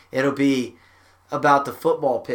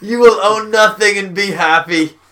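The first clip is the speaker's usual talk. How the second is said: hammed-up evil villain voice, higher and louder; Klaus Schwab; 5 semitones higher, 7 dB louder than usual. Podcaster mocking a global elite figure, most evil voice. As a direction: proclaim it like a scheming overlord. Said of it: "in the most evil voice".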